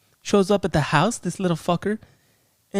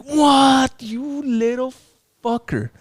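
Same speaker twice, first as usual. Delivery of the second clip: shocked outburst; 11 semitones higher, much slower than usual. Retold or imitated. Viewer reacting in shock to the young priest. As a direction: yell it like you can't believe the betrayal.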